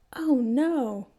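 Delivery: loud, high hammed-up dismay; theatrical mock panic; mock alarm. A narrator's reading. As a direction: play it up for the giggling kid. Playfully attacked by kid.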